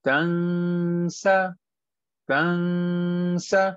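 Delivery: तंसा with the n hidden and drawn out before sa; nasal ikhfa. Shown as a demonstration. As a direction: hold a long nasal hum in तंसा. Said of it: "हम छुपा रहे हैं".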